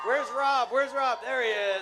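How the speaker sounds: loud, high call across the room; hunting for Rob in the crowd, calling loudly. Real, not acted. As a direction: shout out looking for a friend.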